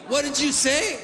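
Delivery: high mock-confrontational question; lightly voiced duck looking for a fight; challenging drake. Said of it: "he goes".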